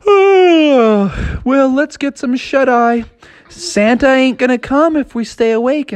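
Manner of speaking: high sister voice; sleepy sister. Acted. Sleepy sister telling Froggy to sleep, high voiced. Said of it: "And she yawned a big yawn".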